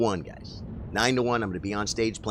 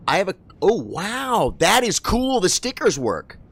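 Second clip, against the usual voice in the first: high, loud burst of amazement; delighted surprise; 10 semitones higher, 5 dB louder than usual. Real, not acted. Streamer discovering the chat stickers work, delighted surprise. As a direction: exclaim it, pitch soaring with delight.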